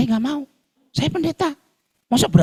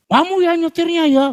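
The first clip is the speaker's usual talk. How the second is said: much higher, louder angry snap; mock angry driver scolding; 4 dB louder than usual. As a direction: bark it like the furious driver.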